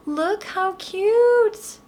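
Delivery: high, very slow cutesy voice; cutesy gush. Real, not acted. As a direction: coo over it like something adorable. Gushing over the product up close.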